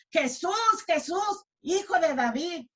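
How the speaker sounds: acted high, urgent call; desperate clamouring. Preacher imitating blind Bartimeo seeking Jesús's attention, acted desperate cry. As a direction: cry out to catch his attention, pitched well up. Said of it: "clamaba con mucha desesperación".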